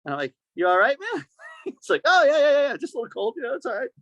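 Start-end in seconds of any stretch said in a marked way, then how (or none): 0.5-1.3 high, surprised how-you-doing; concerned check-in. Retold or imitated. Asking his shivering friend if he's okay, pitched up. Teller voicing himself checking on Craig. 2.0-3.9 high, breezy brushing it off; tough guy. Retold or imitated. Craig playing tough in freezing wind, high mock bravado. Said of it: "Craig being tough, tough guy".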